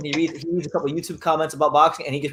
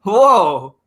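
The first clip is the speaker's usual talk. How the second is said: loud drawn-out whoa; louder amazed whoa; mock whoa; 6 dB louder than usual. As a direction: act stunned at the TV. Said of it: "I'm like".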